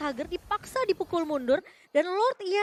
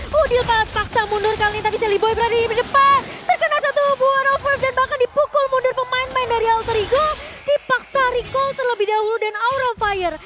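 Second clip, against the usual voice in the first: loud, high, racing hype call; excited play-by-play; 7 semitones higher, 7 dB louder than usual. Real, not acted. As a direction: shout the action as it happens.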